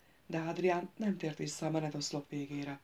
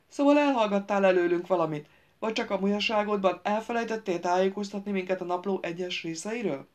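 loud accusation; 3 semitones higher, 7 dB louder than usual. A narrator's reading. Gaunt accusing Miron loudly.

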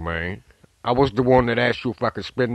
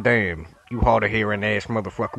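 ordinary talk, nothing marked

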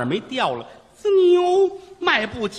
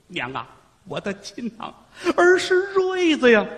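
tearful reunion; 6 semitones higher than usual. Acted. Son kneels, weeping to mother.

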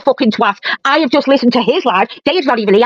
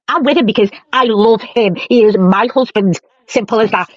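normal talking voice

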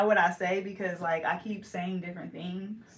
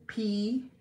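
usual speaking voice

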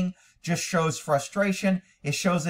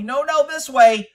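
"No, no, this way" yelled higher, louder and slow; yelling; 8 semitones higher, 7 dB louder than usual. Retold or imitated. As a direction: yell "No, no, this way" like nagging the horse. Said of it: "yell at me and tell me".

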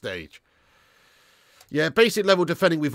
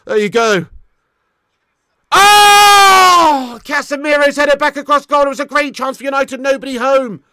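high, very loud outburst at a near miss; excited shouting commentary; 10 semitones higher, 15 dB louder than usual. Real, not acted. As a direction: cry out over the wasted chance. Live reaction to missed chance.

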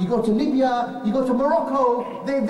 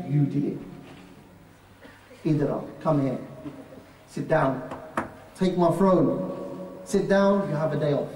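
low, pleased devil king; deep Iblis voice; 8 semitones lower, 3 dB quieter than usual. Acted. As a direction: reward the devil in a low proud growl.

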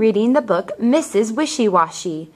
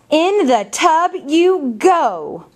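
higher, slow, firm storybook order; bossy farm lady; 6 semitones higher, slower than usual. Acted. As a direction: send them to the bath like a no-nonsense mum. Mrs Wishy Washy orders animals bathing.

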